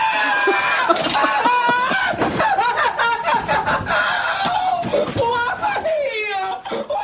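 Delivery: long high shrieking laugh; helpless laughter. Real, not acted. Host losing it over viewer's comment, helpless laughter. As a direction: laugh until you can barely breathe.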